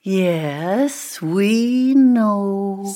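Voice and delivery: drawn-out weary agreement; long-suffering sigh of a reply. A narrator's reading. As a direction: sigh it out, indulgently bored. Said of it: "sighed Ebony".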